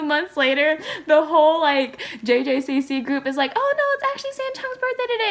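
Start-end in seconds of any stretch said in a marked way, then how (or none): 3.6-5.3 high, fast chirpy voice; mock chirpy announcement. Group telling fans it's his birthday, high and chirpy.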